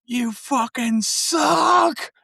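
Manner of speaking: put-on high, slow drawn-out drunk heckle; drunk heckler yell. Drunk fan jeering the band on stage. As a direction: jeer like a drunk in the crowd. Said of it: "he'd go".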